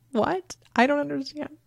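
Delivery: high, soft, hurt-puzzled voice; high-pitched feigned bewilderment; mock baffled mom. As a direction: play innocent in a small high voice. Mom acting out her baffled reply.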